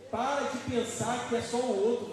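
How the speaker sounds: loud, forceful preaching proclamation; anointed declaration. Shown as a demonstration. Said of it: "Fala em unção, assim, ó".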